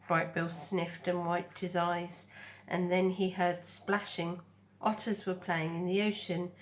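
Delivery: ordinary delivery, nothing marked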